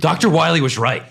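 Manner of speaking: hammed-up conspiracy theorist impression; Alex Jones. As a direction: do a mock outraged radio ranter. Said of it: "That's my Alex Jones impression".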